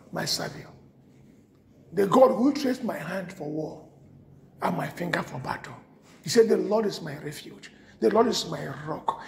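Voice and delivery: low, soft scripture reading; reverent psalm reading. Acted. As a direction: read it like a quiet prayer.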